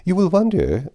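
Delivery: a hint of a poised older lady beginning an excuse; Lady Russell's voice. A narrator's reading.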